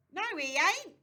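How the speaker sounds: put-on a boy's loud, high defiant yell; furious shout. A narrator's reading. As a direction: yell it back at the preacher. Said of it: "Jim shouted".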